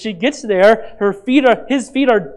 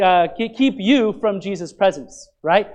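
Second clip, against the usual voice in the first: ordinary talk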